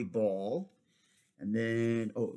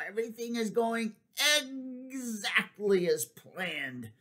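gloating villain egg; 10 semitones higher than usual. Acted. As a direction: savor the evil plan.

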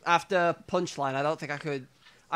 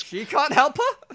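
high slow mock voice; 9 semitones higher, 5 dB louder, slower than usual. Repeating the video line.

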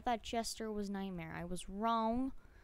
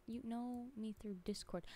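whisper; 9 dB quieter than usual. Real, not acted. Whispered reading of chat.

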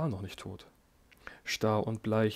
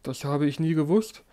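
higher, louder anguished cry; emperor cries out; 5 semitones higher, 7 dB louder than usual. A narrator's reading. Dying emperor cries out in despair. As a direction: burst out in distress.

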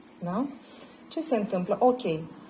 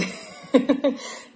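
breathy unvoiced burst of laughter, louder and higher; real laugh; 7 semitones higher, 6 dB louder than usual. Real, not acted. Laugh after her own remark. (râs scurt, sincer, fără voce)

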